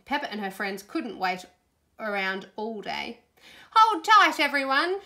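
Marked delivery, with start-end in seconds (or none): 3.7-5.0 loud, high rallying call; excited, high-pitched shout; crying out. A narrator's reading. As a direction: call a warning to all the passengers. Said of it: "she cried".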